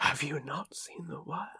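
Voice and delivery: slow, soft ASMR whisper. Pretend. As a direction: breathe it slowly like ASMR. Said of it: "a bit of A S M R".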